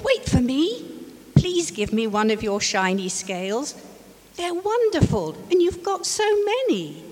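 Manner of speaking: high, eager little fish begging. Acted. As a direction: plead sweetly with a big friend. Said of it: "he called".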